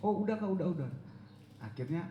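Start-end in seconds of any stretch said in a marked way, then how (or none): 0.0-0.9 high, louder retold assurance; hurried placating. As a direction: imitate yourself fending off a pestering friend. Hurried answer to pressing caller. (buru-buru meyakinkan, nada tinggi)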